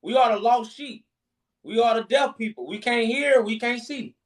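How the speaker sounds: ordinary talk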